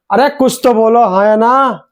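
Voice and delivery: ordinary talk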